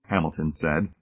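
ordinary delivery

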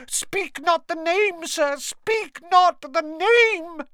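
high, loud and slow, begging; moaning plea. A narrator's reading. Dobby begging Harry not to say the name, high loud slow plea. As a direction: wail it, dragging the words out. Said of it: "and moaned".

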